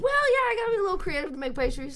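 high bright laughing retort; tossing back the host's joke with a laugh; excited laugh. Real, not acted. Guest laughing back at the host's pastry joke, excited.

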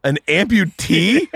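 high, slow, disbelieving echo; incredulous question. Retold or imitated. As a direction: repeat it as a drawn-out, high, baffled question. Daughter's shocked reply to advice.

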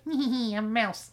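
high, squeaky little mouse giggle. Pretend. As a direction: giggle like a sneaky tiny mouse. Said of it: "be like".

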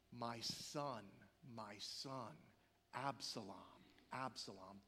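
low, halting mourning, imitated; retold tearful grief. King David told his son is killed, grieving through tears. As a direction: lament softly in a low voice, pausing after each name. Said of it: "Through tears, he utters these words".

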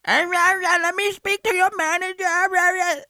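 high, slow mock Karen demanding the manager. Friend mocks a Karen demanding the manager, in a high slow whine. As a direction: whine it up high like an entitled customer. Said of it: "A Karen comes in".

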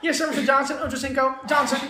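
high, mock-respectful yes sir; mock servant voice. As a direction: play an eager butler, lightly. Mock deferential addressing Ochocinco.

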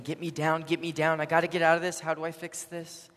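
loud, urgent begging; imitated frantic plea. Man on the cross wants out, mock-frantic. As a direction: rattle off panicked demands to escape. Panicked plea, contrasted with Jesus' prayer.

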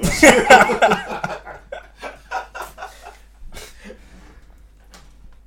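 long hearty laugh; long loud laugh. Real, not acted. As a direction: crack up hard. Laughing at risky joke.

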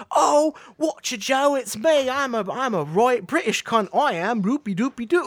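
high, loud, hammed-up British caricature; mock British accent. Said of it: "I'm allowed to do that. I'm English".

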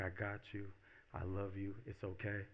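soft, gentle comforting; tender reassurance. Acted. Film's man wiping her tears, tenderly reassuring. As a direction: hush someone's worry quietly.

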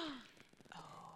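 breathy high gasp; dramatic gasp. Acted. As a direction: gasp in mock horror. Mock shock at plot twist.

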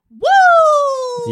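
long high hollered woo; crowd woo. Retold or imitated. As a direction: howl it like a stadium crowd. Imitating crowd chant at event.

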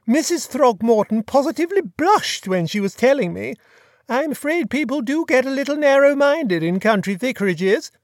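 put-on chatty, high-pitched grand old lady; imperious plummy grande dame. A narrator's reading. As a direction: gossip like a fluttery duchess. Duchess describes body.

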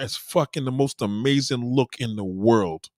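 ordinary talk

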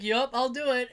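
normal talking voice

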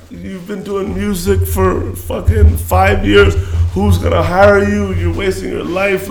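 high, slow nagging mother voice; mom's lecture. Retold or imitated. Son imitates mom scolding about wasted years, high slow nagging. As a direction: nag him like a disappointed mom.

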